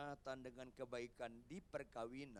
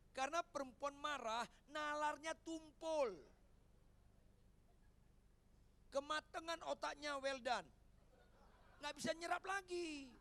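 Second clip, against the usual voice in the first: higher, louder punchline; preaching at full force; 10 semitones higher, 4 dB louder than usual. Acted. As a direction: drive the joke home.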